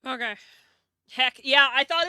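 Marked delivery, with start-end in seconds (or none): 1.1-2.1 much louder burst of glee; excited shout. Real, not acted. Excited cheer about her own world's joke.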